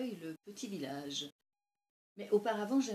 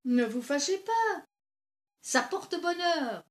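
loud, higher call from the revellers; teasing reassurance; 9 semitones higher, 12 dB louder, slower than usual. Acted. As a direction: call out cheerily to placate her. Youths teasingly placate her over hat. (appel taquin, fort et plus haut)

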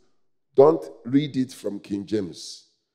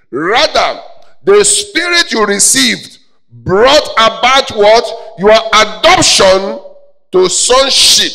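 loud, high, slow shouted scripture; roaring pulpit proclamation; full-force preaching; 9 semitones higher, 13 dB louder, slower than usual. Real, not acted. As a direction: thunder each phrase out, stretched and separate.

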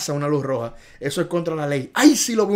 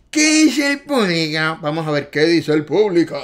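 host call; 6 dB louder than usual. Acted. Quiz host calling to audience.

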